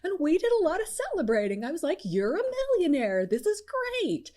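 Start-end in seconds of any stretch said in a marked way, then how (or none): 2.0-4.3 high, excited congratulations; celebratory exclamation. Retold or imitated. Coach relives congratulating a new millionaire, celebratory exclamation. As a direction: pitch soars as you celebrate.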